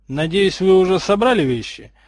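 accusing tone. A narrator's reading. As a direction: ask it as if they are to blame. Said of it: "спросил он таким тоном, будто это мальчики виноваты".

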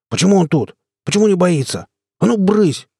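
high-pitched, agitated outburst. A narrator's reading. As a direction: build from fretting questions to a sharp shoo. Agitated character shoos the raccoon. (взволнованно, срываясь на окрик)

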